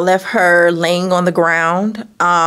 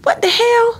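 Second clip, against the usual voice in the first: much higher, alarmed outburst; startled shock. Retold or imitated. Hearing pops hit her window, startled shriek. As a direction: jump at the noise in alarm. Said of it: "And I'm like".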